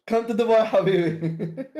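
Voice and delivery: hammed-up Dubai real estate come-on, laughing; mock Arabic ad pitch. Teasing a friend with a Dubai sales pitch, hammed-up Arabic accent.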